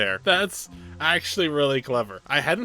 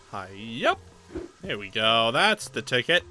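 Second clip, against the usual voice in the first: ordinary delivery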